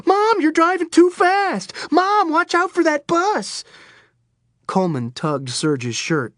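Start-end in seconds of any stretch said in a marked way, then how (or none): 0.1-3.6 panicked screaming. Retold or imitated. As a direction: shriek it up high like a scared kid. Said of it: "I'm screaming the whole way over".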